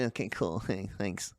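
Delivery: a disappointed fan muttering thanks; mock half-hearted thanks; sulky thanks. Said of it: "it was kinda like a".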